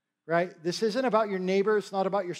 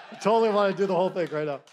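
laughing excitement; 4 dB louder, much faster than usual. Real, not acted.

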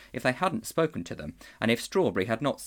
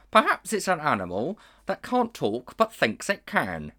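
higher, louder bright donkey; 6 semitones higher, 4 dB louder than usual. A narrator's reading. Donkey brightly offering an idea. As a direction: pipe up cheerfully as a donkey. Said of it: "said the donkey brightly".

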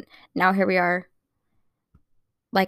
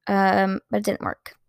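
ordinary talk

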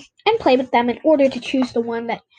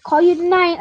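louder, higher call; shout; 5 semitones higher, 5 dB louder than usual. A narrator's reading. Narrator calls to Mal as they part, louder. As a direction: call after a friend walking off. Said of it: "I shouted to Mal".

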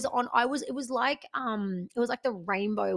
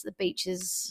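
whispered tip; 6 dB quieter, slower than usual. Real, not acted. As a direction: drop to a whisper. Secret tip.

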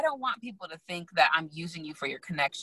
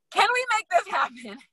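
high, squeaky mock begging; pleading; 13 semitones higher than usual. Designer texting Megan after another year, pleading.

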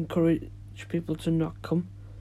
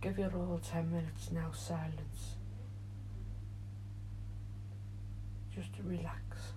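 soothing guided relaxation, fading quieter; guided meditation; 9 dB quieter than usual. Acted. Leads a meditation.

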